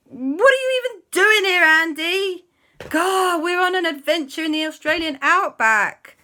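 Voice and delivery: high, loud acted indignation; exasperated scolding. Friends questioning Andy in the outback, exasperated.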